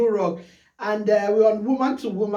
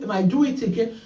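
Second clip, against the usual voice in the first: ordinary talk, nothing marked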